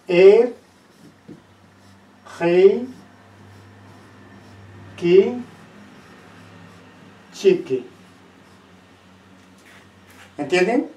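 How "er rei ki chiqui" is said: spaced-out dictation of "er, rei, ki, chiqui"; word demonstration. Shown as a demonstration. Teacher showing students how a Quechua word sounds, slowly dictated in pieces. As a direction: say "er, rei, ki, chiqui" piece by piece, long pauses between. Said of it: "si nosotros decimos".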